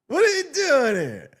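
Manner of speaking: a lightly voiced stunned Lando; Lando Calrissian impression. Streamer redoing his Lando line, high and stretched. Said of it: "my Lando Calrissian impression".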